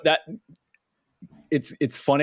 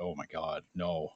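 hushed dismay; 6 semitones lower, 12 dB quieter than usual. Retold or imitated. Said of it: "I was just like".

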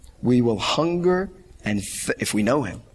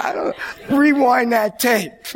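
higher, spluttering through laughter; laughing; 9 semitones higher, 3 dB louder than usual. Retold or imitated. Husband laughing, asking to rewind the tape. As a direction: laugh your way through the excuse. His reply while laughing.

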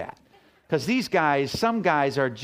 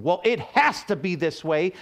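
higher, louder domineering head of house; bossy husband; 6 semitones higher, 3 dB louder than usual. Retold or imitated. Pastor mocking a domineering husband, loud and bossy. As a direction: lay down the law as the self-important husband. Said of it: "for it is not self-seeking".

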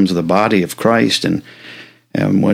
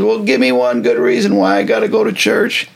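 high, whiny mock complaint; husband whining that he won't go to church; exasperated husband; 13 semitones higher than usual. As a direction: plead like a grumpy husband who won't get up. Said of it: "He said".

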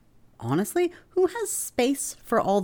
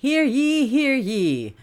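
town crier; 10 semitones higher, 4 dB louder, much slower than usual. Pretend. Opening the episode like announcement.

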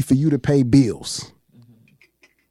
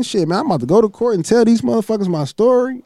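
eager inner voice; 7 semitones higher than usual. Retold or imitated. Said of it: "you know me thinking".

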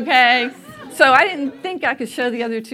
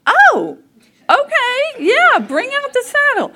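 high, bright put-on cheer, louder; faking unshocked; 13 semitones higher, 4 dB louder, slower than usual. Hiding shock from mom as the saddle comes out, forced cheer. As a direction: act fine while you're stunned. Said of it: "you're not supposed to look shocked".

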